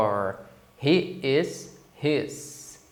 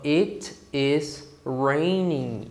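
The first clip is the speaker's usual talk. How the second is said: slow model phrase; much slower than usual. Shown as a demonstration. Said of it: "eu falo".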